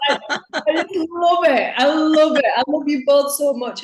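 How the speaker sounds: high, loud, still laughing through the words; laughing joy. Real, not acted. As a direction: gush with laughter and love. Laughing affection after impression.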